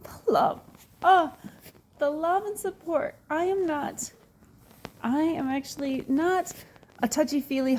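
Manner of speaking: real emotion breaking through, voice pitched high; choked up, near tears. Real, not acted. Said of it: "Maybe just a little".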